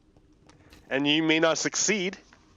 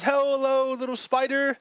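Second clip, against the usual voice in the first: Buddha greeting; 12 semitones higher than usual. Acted. Buddha saying hello to the little spider, high singsong voice. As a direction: greet a tiny creature in falsetto. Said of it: "And Buddha says".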